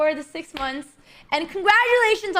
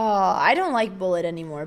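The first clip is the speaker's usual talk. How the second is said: ordinary delivery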